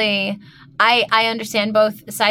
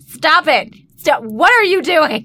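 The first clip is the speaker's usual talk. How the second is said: sharp, higher, louder cut-off; imitating an aggressive telling-off; aggressive casting director; 6 semitones higher, 5 dB louder than usual. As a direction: shut the actor down hard, mid-read. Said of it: "was pretty aggressive".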